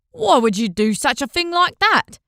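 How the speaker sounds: high, loud disbelieving question; can't believe the offer; baffled merchant. A narrator's reading. Merchant baffled by Guyon's offer, disbelieving.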